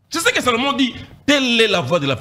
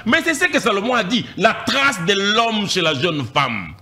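normal talking voice

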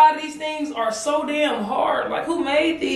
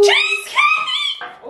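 high, slow shouted announcement; shouting out the cheesecake she drew; excited shout; much slower than usual. Real, not acted. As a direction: announce it like a game-show prize. Announcing the treat.

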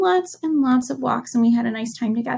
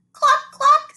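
high, louder mock clucks; playful high-pitched chicken clucks; high clucking hen; 13 semitones higher, 5 dB louder than usual. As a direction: cluck it like a busy little hen. Chicken's line in story.

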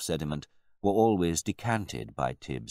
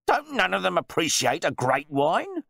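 loud, high-pitched exasperation; blustering, boorish wine snob; pompous host grumbling; 6 dB louder than usual. A narrator's reading. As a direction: complain indignantly about the guests. Host complains about his guests.

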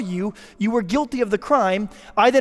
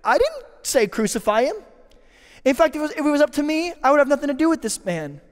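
defensive Pilate voice; 7 semitones higher, faster than usual. Retold or imitated. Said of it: "imitating mankind as Pilate".

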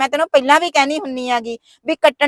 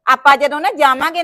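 ordinary talk

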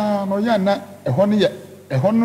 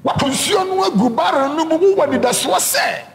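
raised, ringing preacher voice; preaching; 6 semitones higher than usual. Acted. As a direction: drive the point home to the congregation. Preaching the lesson.

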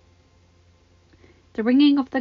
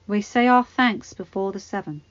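raised, solemn priestly voice; 7 dB louder than usual. Acted. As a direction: intone it like a pious holy man. Prayer at small council.

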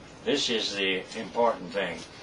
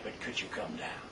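barely voiced, much softer whisper; hushed plea; 11 dB quieter than usual. Caller asking the doctor again, whispering the plea. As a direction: let the plea fall to a whisper. Hospital caller pleading at night.

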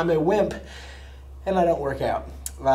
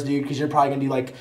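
ordinary talk, nothing marked

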